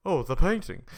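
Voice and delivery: acted realization. Retelling the painting joke, loud mock realization. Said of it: "He's like".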